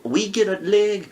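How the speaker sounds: ordinary talk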